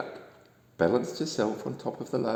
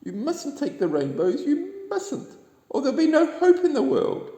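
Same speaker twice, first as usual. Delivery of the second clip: very high, loud pleading cry; frantic duck voice; Little Duck shouting; 5 dB louder than usual. A narrator's reading. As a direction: squeal it out to stop the thieves. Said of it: "shouted Little Duck".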